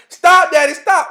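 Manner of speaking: high-pitched mock yell; imitated child's yelling. Child begging Daddy to stop the whooping, high yell. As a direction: yelp it out like a child being whipped. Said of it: "when the baby was yelling".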